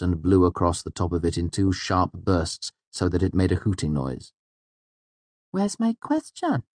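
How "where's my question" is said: put-on high-pitched, insistent girl; demanding Auri. A narrator's reading. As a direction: demand it in a high little character voice. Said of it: "she demanded".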